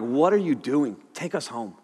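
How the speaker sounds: soft, understated imitation of tearful passengers; subdued upset plea. Tearful passengers asking the driver to leave, soft plea. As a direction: plead quietly, dropping low at the end. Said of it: "these people who love me are in tears".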